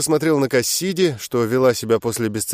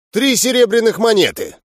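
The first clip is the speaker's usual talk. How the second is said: lively exclamation; 8 semitones higher, 4 dB louder than usual. A narrator's reading. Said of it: "оживлённо воскликнул Куеперст".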